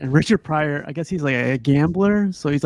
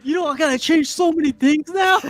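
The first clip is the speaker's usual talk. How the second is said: mock panic; 13 semitones higher, 3 dB louder than usual. Retold panicked reaction.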